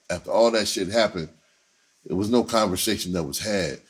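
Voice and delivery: ordinary talk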